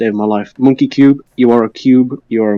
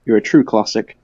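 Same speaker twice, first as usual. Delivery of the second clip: ordinary delivery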